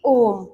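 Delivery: "ओम" held long and slow as a prolonged vowel; teacher showing the longest vowel with ओम; drawn-out ओम. Shown as a demonstration. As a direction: stretch the vowel in "ओम" out slowly. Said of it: "जब हम ओम का उच्चारण करते हैं".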